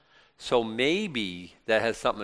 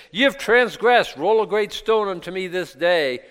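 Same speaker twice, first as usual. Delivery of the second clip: stern rebuke; 7 semitones higher, 5 dB louder than usual. Acted. Saul rebukes the people.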